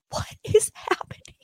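high breathless whisper; stunned whisper. Real, not acted. [name] stunned by Lindsay, high whispered disbelief. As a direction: gasp it out in disbelief.

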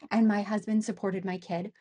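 ordinary delivery

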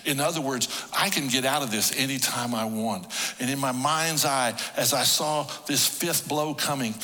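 ordinary talk, nothing marked

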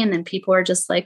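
ordinary delivery

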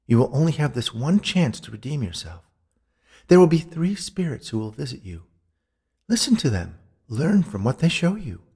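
high, solemn ghostly warning; admonishing ghost woman. A narrator's reading.

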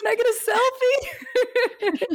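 very high, slow squeak; nervous fan squeak; squeaky timid ask. Retold or imitated. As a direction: ask shyly in a tiny high voice. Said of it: "I just like".

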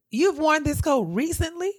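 retold disbelieving query; incredulous question. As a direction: question it in disbelief, rising at the end.

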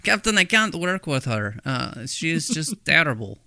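high, loud mock Russian accent, complaining; a deliberately bad Russian accent protesting to the captain; terrible Russian accent. Chekhov complaining to his captain about Irina, bad Russian accent. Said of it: "that's my terrible Russian accent".

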